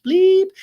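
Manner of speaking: a sharp, high, loud beep sound; mock censor bleep. Recapping the fight for listeners, she voices the censor bleep loudly. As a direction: bleep out the swear word with your voice.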